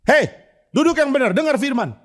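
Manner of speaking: high, loud mock rebuke; Pak Tong scolding. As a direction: bark at the congregation to sit properly. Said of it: "pendeta ngamuan".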